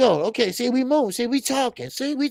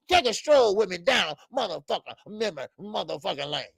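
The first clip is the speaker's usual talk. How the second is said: normal talking voice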